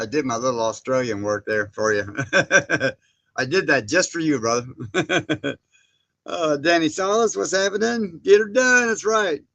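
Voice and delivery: ordinary delivery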